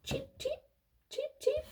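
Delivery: very high, soft chirps; cricket chirp. Acted. Parent reading, Cricket chirps smartly at night. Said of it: "Cricket chirped smartly".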